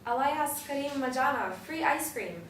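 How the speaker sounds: put-on loud, high Arabic accent call; Arabic accent shout. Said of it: "shouting in a perfect Arabic accent".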